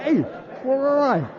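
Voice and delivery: put-on high, loud, dazed drunk voice; hammed-up drunk asking where he is; confused drunk.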